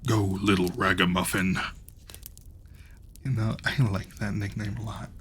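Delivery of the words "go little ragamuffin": giant voice. Acted. Giant sends the tailor off for water, lightly voiced giant. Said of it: "the giant said to him".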